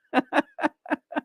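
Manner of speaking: high-pitched burst of real laughter; amused laugh. Real, not acted. Streamer tickled by their own accent, laughing. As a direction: crack up at yourself.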